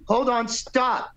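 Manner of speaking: high, drawn-out mock call; acted high, slow interruption; imitating abrupt halt. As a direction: call a sudden stop to the music. [name] halts the audition.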